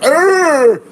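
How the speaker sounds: loud dumb grunt; blank stare grunt. Retold or imitated. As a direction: grunt like a confused caveman. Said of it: "Just like".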